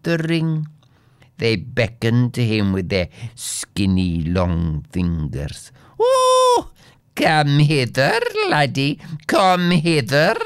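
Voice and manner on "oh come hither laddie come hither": high, beckoning goblin call; goblins shouting. A narrator's reading. Goblins beckon the earl to join their dance, shouting. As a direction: call out to lure him in. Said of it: "they shouted".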